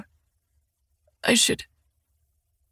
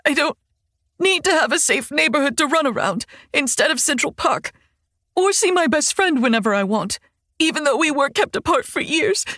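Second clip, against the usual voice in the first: crying. Acted. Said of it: "tears still spilling, and she hiccups".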